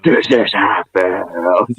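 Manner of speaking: slow, stretched delivery in a light put-on voice; lightly hammed-up impression; mock dramatic Monts. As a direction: drag out each word like a hammed-up hero. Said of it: "I bet he came out like".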